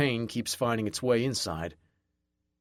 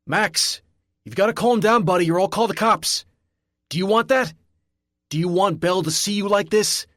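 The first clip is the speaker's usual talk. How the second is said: much higher and louder, urgent pleading; frantic security guard; 8 semitones higher, 6 dB louder than usual. A narrator's reading. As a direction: shout him back to his senses.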